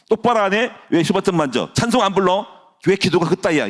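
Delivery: high-pitched, imitated telling-off; mock scolding. Preacher shows congregation what he avoids, mock scolding. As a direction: rattle off reproaches like a strict leader.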